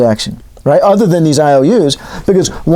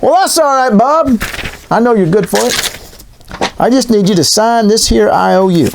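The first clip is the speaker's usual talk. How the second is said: storekeeper Tom; 6 semitones higher than usual. Retold or imitated. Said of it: "Tom says".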